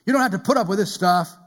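acted indignation, loud and emphatic; raging inner voice. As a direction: play the inner voice egging someone on, loudly. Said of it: "there's this raging voice inside of them".

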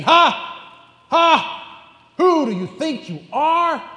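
loud, high mocking ha, ha; scornful laugh. God scoffing at rebellious humanity, loud high mocking laughter. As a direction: laugh down at puny man. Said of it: "God looking at recalcitrant humanity and saying".

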